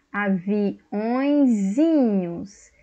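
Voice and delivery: aviõezinhos stretched out syllable by syllable; slow demo word. Shown as a demonstration. Teacher showing the plural diminutive, very slow. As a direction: sound out aviõezinhos very slowly. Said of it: "Então, avião".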